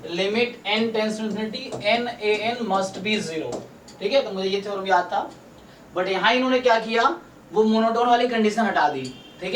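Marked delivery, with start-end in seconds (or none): 0.1-3.6 very slow, word by word, like dictating notes; slow dictation. Shown as a demonstration. Teacher dictating a theorem to students, slow and measured. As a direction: spell the theorem out slowly for students to write.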